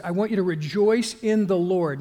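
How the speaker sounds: REJOICE hit hard and loud; with emphasis. Retold or imitated. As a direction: punch "rejoice" like a spoken exclamation point. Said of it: "he's saying with emphasis".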